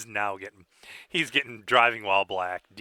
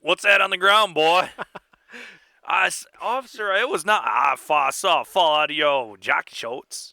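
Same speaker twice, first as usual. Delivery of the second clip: higher, louder Southern cartoon-rooster accent; Foghorn Leghorn accent; 6 semitones higher, 4 dB louder than usual. Co-host plays a South Carolina cop and Earl in a Foghorn Leghorn-style Southern accent. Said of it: "That's my foghorn leghorn accent".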